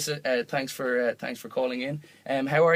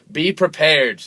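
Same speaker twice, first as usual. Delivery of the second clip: loud, raised mock warning; mock ominous warning; 5 semitones higher, 10 dB louder, slower than usual. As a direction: announce it like a doom-laden public warning. Mock warning, then taken back.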